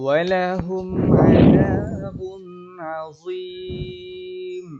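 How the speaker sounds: slow, high, drawn-out tajweed chant; Quran recitation. Shown as a demonstration. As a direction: sing the verse slowly with long held vowels. Repeats the model verse.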